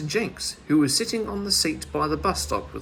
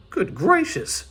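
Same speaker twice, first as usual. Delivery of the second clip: high, startled exclamation; alarmed; 8 semitones higher, 5 dB louder, slower than usual. A narrator's reading. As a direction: gasp it out in alarm. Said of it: "quite alarmed".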